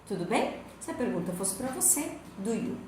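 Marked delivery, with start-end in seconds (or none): none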